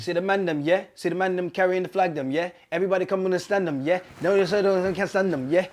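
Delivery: playful Jamaican patois. Pretend. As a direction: toast it like a dancehall MC. Patois bit continues.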